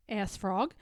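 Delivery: ordinary delivery, nothing marked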